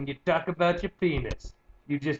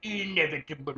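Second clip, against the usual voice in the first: put-on posh British accent. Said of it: "say with a British accent".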